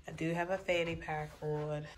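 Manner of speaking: soft laughing whisper, half to herself; amused hushed confession; giggly whisper. Real, not acted. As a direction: whisper it with a little laugh, a bit embarrassed. Laughing aside about fanny pack.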